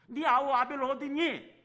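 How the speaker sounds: high, loud, drawn-out call; preacher voicing God's insistent question; God urging repentance. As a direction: call out slowly to draw a confession. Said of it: "회개하기를 원하시는 것입니다".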